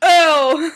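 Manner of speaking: sudden yelped hiccup; loud hiccup yelp. Real, not acted. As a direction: let a hiccup burst out. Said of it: "I can't stop hiccuping right now".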